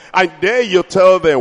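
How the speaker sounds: ordinary talk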